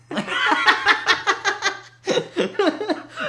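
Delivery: high burst of loud laughter. Real, not acted. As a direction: cracks up loudly, high-pitched. Joke about not talking to them.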